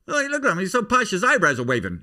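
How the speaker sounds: high, loud heckling comic; Don Rickles. Retold or imitated. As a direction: imitate an insult comic calling out the crowd. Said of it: "Don Rickles would say".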